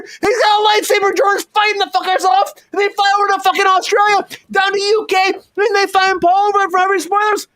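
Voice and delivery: high, giddy, runaway riff; manic excited rant. Acted. Signing off to viewers, a wild high excited riff. Absurd story keeps escalating.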